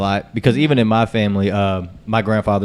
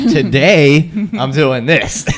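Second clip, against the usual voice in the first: slow, higher, half laughing; amused, drawn-out shrug; 6 semitones higher, 6 dB louder, much slower than usual. Retold or imitated. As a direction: spell it out like it's obvious. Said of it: "I'm just like".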